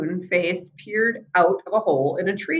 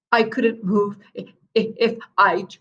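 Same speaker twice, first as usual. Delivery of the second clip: stammered. A narrator's reading. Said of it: "he stammered".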